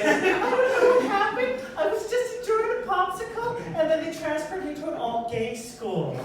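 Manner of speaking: high, loud, singsong camp schoolboy; camp student voice. Acted. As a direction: squeal it like a baffled schoolboy. Student voiced, confused about transfer.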